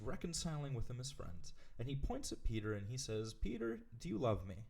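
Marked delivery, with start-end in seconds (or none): 3.3-4.6 a lightly voiced Jesus, asked higher; mild Jesus voice, pitched up; voicing Jesus. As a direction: ask it as Jesus would, gently probing. Voicing Jesus questioning Peter.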